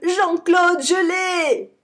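high loud victorious shout; triumphant shout. A narrator's reading. Billy shouts his win to Jean-Claude, high loud. Calling friend after catching bison.

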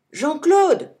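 loud high call across to a friend. A narrator's reading. Billy calls down from the tree, loud high call. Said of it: "crie-t-il".

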